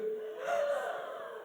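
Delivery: very high, breathy mock gasp; theatrical shocked gasp; dramatic gasp. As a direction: gasp in pretend horror. Repeated gasp on retake.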